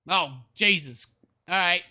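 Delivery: normal talking voice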